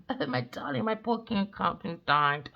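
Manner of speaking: lightly mock her tearful speech at the microphone; mock grieving widow. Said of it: "on the mic saying".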